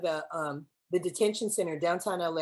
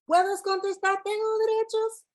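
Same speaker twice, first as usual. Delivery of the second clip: 1 cry out; 12 semitones higher than usual. Acted. Climax of performed poem.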